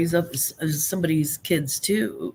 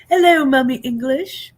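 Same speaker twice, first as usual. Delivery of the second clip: higher, louder sing-song hello; playful greeting; 8 semitones higher, 6 dB louder than usual. Pretend. Host playfully greeting the guest by her nickname, sing-song. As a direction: greet her like the Swiss kids would. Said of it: "our Swiss friends' kids call me Mummy English".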